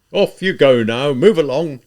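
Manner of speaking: loud, slow order; shouting. A narrator's reading. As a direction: shout to disperse the crowd. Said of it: "he shouted".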